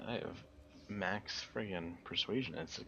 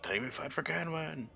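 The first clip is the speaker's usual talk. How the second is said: mocking the speech; 10 semitones higher than usual.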